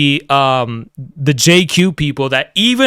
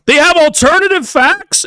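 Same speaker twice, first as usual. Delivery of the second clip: mock liberal; 11 semitones higher than usual. Mocking a member lambasting the group like a liberal, high indignant voice. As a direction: play an outraged liberal scolding, pitched up. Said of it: "lambasting us like a liberal".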